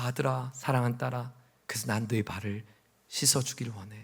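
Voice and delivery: very soft, low, loving murmur; hushed, intimate appeal; tender Jesus. Acted. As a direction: speak gently to a beloved child. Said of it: "다그치고 책망하고 우리를 향해서 ... 것이 아니라".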